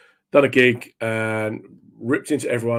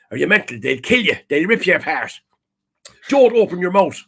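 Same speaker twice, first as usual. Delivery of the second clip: alarmed Irish mum; 6 semitones higher than usual. Retold or imitated. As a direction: imitate a mum's dire warning, broad accent. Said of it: "And she's like".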